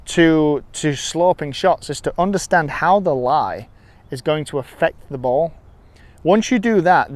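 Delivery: ordinary delivery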